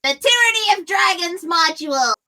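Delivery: different voice. Acted. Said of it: "I should do this in a different voice".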